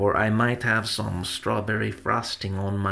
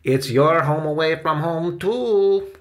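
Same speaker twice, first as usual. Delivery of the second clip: higher, cheery character voice rising at the end; cheerful; 6 semitones higher, 4 dB louder than usual. A narrator's reading. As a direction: chime in, lifting the last word. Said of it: "said a cheerful voice".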